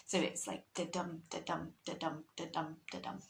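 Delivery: da-dum, da-dum with the beat on each dum; iambic rhythm. Shown as a demonstration. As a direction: tap out the iambic beat, weak then strong. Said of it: "five stresses per line".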